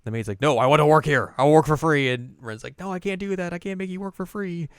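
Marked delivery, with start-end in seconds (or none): 0.4-2.1 loud, high, insistent; insistent loud reply. Retold or imitated. Maid voiced insisting. 2.8-4.7 high-pitched firm refusal; high girl voice. Retold or imitated.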